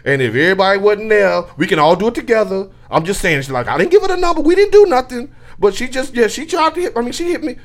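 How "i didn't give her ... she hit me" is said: high, frantic denial; caught red handed. Retold or imitated. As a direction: deny it in a panic. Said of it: "I sound guilty as hell".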